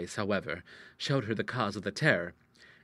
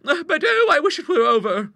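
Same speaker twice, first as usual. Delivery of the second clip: put-on very high, loud, fluttery old-fashioned lady; agree, then fret in a high voice; anxious maiden lady; 11 dB louder than usual. Sister dreading tomorrow's call, high and anxious.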